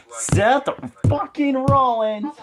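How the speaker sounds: high, drawn-out yell of a name; hyped-up shout. Pretend. Fan blurts out Seth Rollins' name, hyped-up shout. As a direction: belt the name out slowly and high.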